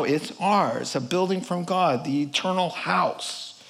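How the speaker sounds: usual speaking voice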